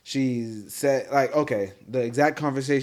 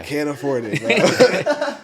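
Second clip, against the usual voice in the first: laughing; 3 semitones higher, 8 dB louder than usual. Real, not acted. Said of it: "laughing repeat".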